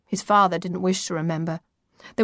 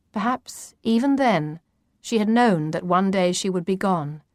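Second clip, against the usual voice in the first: usual speaking voice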